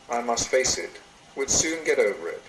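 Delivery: normal talking voice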